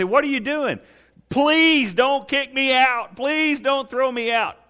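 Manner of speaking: put-on frightened child begging the parent, slow and high; scared child pleading. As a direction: beg not to be thrown out.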